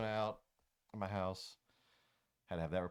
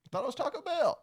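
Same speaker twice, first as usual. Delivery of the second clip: much higher squeaky voice; high squeaky punchline; 6 dB louder than usual. Retold or imitated. Joke punchline.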